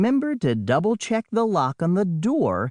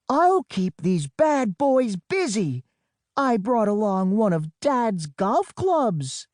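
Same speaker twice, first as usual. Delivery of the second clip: plucky bravado; 6 semitones higher than usual. Acted. Storm bravely taking on sharks.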